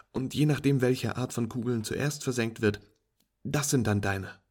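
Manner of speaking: walk a beginner through it brightly; patient instructing friend. Acted.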